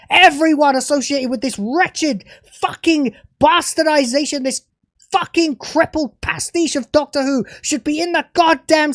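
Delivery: real fury at the showrunner; raging at the writers of the show; angry rant. Real, not acted. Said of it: "This angry rant".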